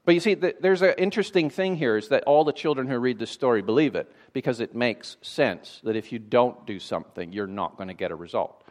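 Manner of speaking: normal talking voice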